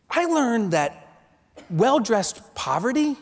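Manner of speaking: high, loud, slow proclamation; slow, declaiming keynote voice; speech climax. Acted. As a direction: hammer home the lesson. Big lesson.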